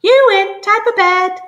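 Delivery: very high, loud bird squawk; cockatoo squawk. Acted. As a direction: shriek it like a parrot. Cockatoo Clive keeps squawking.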